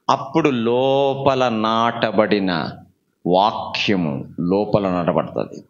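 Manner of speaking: very low, slow and measured; solemn teaching. Real, not acted. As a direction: sink low and space out each word. Solemn teaching after forceful exhortation. (గంభీరంగా, నెమ్మదిగా, తగ్గు స్వరంతో)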